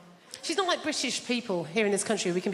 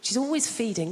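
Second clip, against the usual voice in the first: ordinary talk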